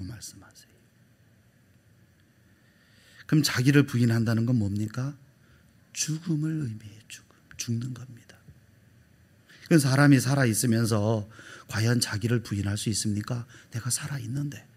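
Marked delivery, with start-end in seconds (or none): 5.9-8.4 hushed, solemn whisper. Real, not acted. Preacher telling the congregation self-denial means death, whispered. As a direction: breathe the words out.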